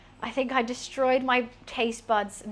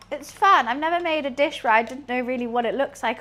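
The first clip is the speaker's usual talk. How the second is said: ordinary talk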